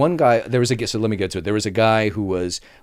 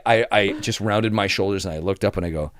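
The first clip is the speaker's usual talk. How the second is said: ordinary talk, nothing marked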